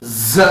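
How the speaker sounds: buzzing ز held with a hard edge; letter z demo. Shown as a demonstration. Teacher showing students the letter sound, buzzing it. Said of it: "اسی طرح اس کی آواز بھی نکلے گی".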